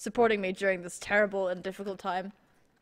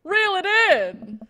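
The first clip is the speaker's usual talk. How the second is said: excited command; 9 semitones higher, 8 dB louder, slower than usual. Acted. Exclaimed command in story.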